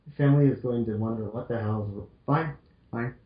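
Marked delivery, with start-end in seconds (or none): none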